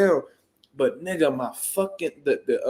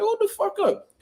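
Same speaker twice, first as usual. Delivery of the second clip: very high, urgent cry again in the retelling; high-pitched panicked warning; retold alarm. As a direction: stop him before he jumps in.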